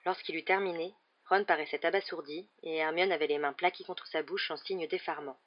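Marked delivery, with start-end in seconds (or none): none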